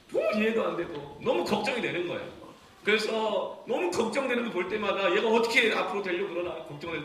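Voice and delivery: loud, high, pressing recollection; heated preaching. Real, not acted. Preacher tells congregation of disciplining his teenage son, heated. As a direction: pour out a worried father's exasperation, loudly.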